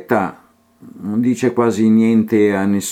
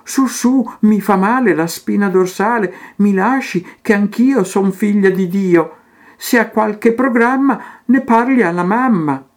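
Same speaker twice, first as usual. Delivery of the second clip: higher, mock-pleading protest; 9 semitones higher than usual. Teresa pleading with the painter, higher and mock-pleading. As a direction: plead with a cheeky warning edge. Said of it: "supplicando, Teresa gridò".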